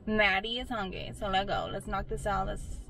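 ordinary delivery

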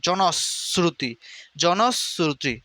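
জনশ্রুতি slowly split "jonos... sruti"; s after jono. Shown as a demonstration. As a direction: close on s midway through জনশ্রুতি. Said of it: "জনর পরে স উচ্চারণ করতে হবে". (জনশ্রুতি ভেঙে "জনোস্... স্রুতি")

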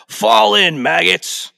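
loud, slow drill sergeant bark; Sarge. Retold or imitated. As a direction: bark orders at new recruits. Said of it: "with this impersonation".

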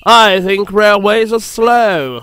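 slow, drawn-out disdain; bored voice. A narrator's reading. Harold looks down on railways, bored. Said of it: "in a bored voice".

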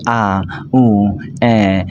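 "আ", "উ", "এ" as separate long, level vowels; demonstrated vowel sounds. Shown as a demonstration. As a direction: sound out "আ", "উ", "এ" one by one, held evenly. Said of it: "স্বরবর্ণ সে sound করতে শেখে". ("আ", "উ", "এ" আলাদা করে টেনে উচ্চারণ)

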